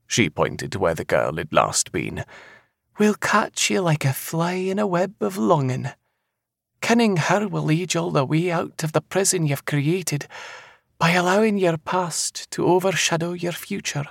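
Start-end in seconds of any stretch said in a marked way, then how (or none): 3.0-14.0 high Scottish prophetess; eerie seer. Acted. Seer warning the man of a lass, high and knowing. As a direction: foretell it high, with certainty.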